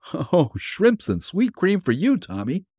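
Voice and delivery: beaming over the cat like a proud owner; excited call. A narrator's reading. Bill calling out to the cat, excited. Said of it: "he shouted to the cat".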